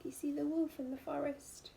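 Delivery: plain reading voice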